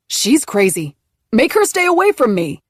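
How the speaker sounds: high, loud shout. Acted. Man retreating into store shouting at police, loud. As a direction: fling it back while retreating.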